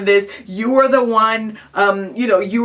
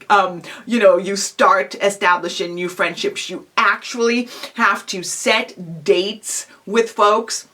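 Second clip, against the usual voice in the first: usual speaking voice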